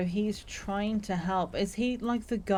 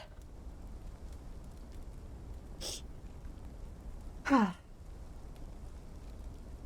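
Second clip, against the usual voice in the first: a slow, soft exhale of dawning realisation; long heavy sigh; 3 semitones higher, 11 dB quieter than usual. Real, not acted. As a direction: let out a long, quiet sigh. Streamer realises who it is.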